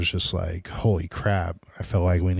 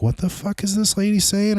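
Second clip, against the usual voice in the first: high-pitched retold alarm; freaked-out inner voice, pitched way up; bewildered and spooked, retelling it; alarmed disbelief; 13 semitones higher than usual. Retelling his panicked night.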